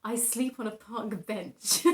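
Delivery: said through a laugh; slow, giggling delivery. Retold or imitated. Joking quote, laughing.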